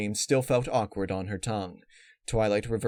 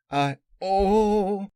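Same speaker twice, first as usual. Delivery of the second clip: a sudden high cry of pain; pained gasp; 11 semitones higher, 7 dB louder than usual. A narrator's reading. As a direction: cut off by a contraction. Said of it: "Apple Rush bent over".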